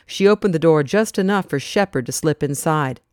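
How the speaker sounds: ordinary delivery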